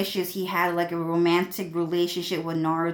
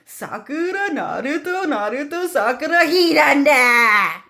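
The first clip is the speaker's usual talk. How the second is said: high sing-song whine building to a loud wail; Sasuke whining; 8 semitones higher, 7 dB louder than usual. Retold or imitated. Showing how Sasuke complains, high whine into wail. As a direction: whine each name up and down, then scream. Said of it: "he just whines and complains like that".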